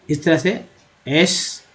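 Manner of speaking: ordinary talk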